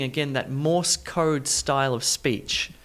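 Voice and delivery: ordinary talk, nothing marked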